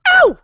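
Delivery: high loud puppy yelp of pain; piercing high yelp; sharp yelp. Retold or imitated. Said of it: "the sibling goes".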